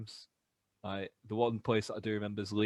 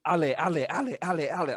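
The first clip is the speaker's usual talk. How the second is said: put-on impatient French kid; 7 semitones higher, 7 dB louder, faster than usual. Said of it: "this little French kid behind me just going".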